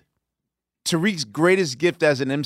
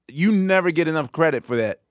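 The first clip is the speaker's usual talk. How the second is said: ordinary delivery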